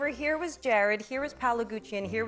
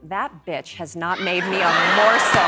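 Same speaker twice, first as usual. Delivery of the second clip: loud laughing outrage; mock outrage; 5 semitones lower, 8 dB louder than usual. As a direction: shout it in playful outrage. Mock outrage about co-star.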